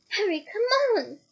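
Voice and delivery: high, loud urgent call. Acted. Hermione pulling Harry away, calling loud and urgent. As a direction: yank him along with your voice.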